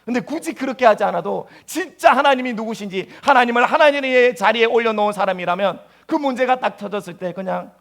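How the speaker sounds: ordinary talk, nothing marked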